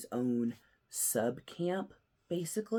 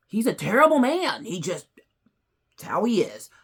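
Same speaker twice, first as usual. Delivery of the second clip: much higher and louder, a hammed-up jab; mock outraged blurt; 8 semitones higher, 12 dB louder than usual. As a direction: blurt it out in playful indignation.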